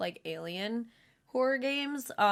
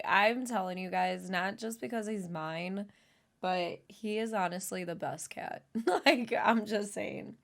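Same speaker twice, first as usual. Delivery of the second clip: usual speaking voice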